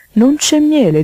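high, loud insistent toad; insistent questioning. Acted. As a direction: demand it, jumping up in pitch and volume.

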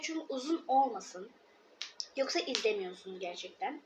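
ordinary talk

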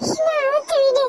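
high, tearful untie me; high crying child voice; crying plea. Acted. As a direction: beg through tears.

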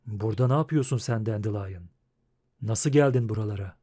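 low, quick, soft-spoken question; Geralt, calm. A narrator's reading. Witcher questioning his agitated friend, calm and low. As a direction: ask it low and unruffled.

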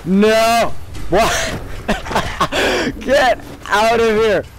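high, frantic cries under fire; panicked yelling. Real, not acted. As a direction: yell in panic as they shoot. Attacked and shot in game.

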